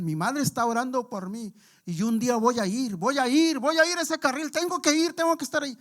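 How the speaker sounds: grito agudo y fuerte, cada vez más alto; gritando como loco. Retold or imitated. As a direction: gritarlo desesperado, fuera de sí. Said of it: "empieza a gritar, hermanos, así como loco". (grito agudo de hombre drogado que clama en la calle)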